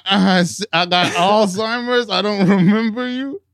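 high, loud, slow fake excuse; mock cheating husband. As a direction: play the lying husband, hammed up. Voicing the husband in the story.

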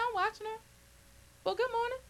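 high, soft, sing-song "good morning"; wary sweet greeting. Retold or imitated. Preacher retelling greeting a wary coworker, high and soft. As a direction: act overly sweet while watching her.

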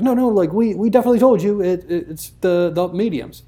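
put-on a touch of a caught kid protesting; mock defensive kid. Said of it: "kinda like one of those".

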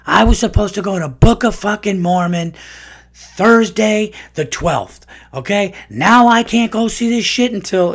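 high, loud whiny meltdown; freaking out. Retold or imitated. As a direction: rant like a furious fan whose show got cancelled. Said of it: "there's somebody freaking out".

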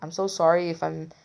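normal talking voice

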